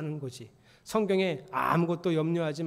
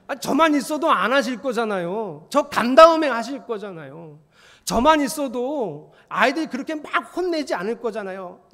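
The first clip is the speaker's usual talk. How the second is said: raised, insistent preaching voice, louder; emphatic louder repeat; 6 semitones higher, 10 dB louder than usual. Acted. Pastor pressing the congregation, loud and insistent. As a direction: push the line out hard the second time.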